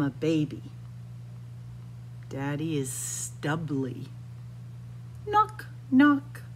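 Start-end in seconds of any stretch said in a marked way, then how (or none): none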